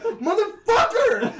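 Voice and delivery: high, loud, drawn-out curse while laughing; laughing mock yell. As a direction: yell it slowly through laughter. Josh yelling at cat, retold.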